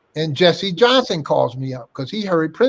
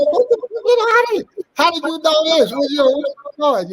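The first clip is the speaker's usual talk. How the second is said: way up high, stunned; shocked disbelief; 11 semitones higher than usual. Retold or imitated. Hearing from his lawyer about the fight, stunned disbelief. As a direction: sound floored by the news. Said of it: "I'm going".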